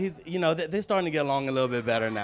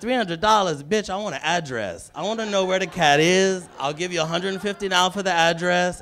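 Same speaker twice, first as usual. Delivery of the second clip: higher, louder outraged haggling; indignant retort; 5 semitones higher, 6 dB louder than usual. Retold or imitated. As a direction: push back hard at the psychic's price. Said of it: "I said".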